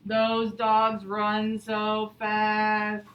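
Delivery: very slow, drawn-out dull read; very slow and flat. Shown as a demonstration. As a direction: drag every word boringly. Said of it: "Is that how I should read it?".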